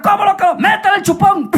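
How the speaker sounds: high-pitched urgent order; frantic parent. Acted. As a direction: cry it out in a hurried panic. Parents panic at crying baby.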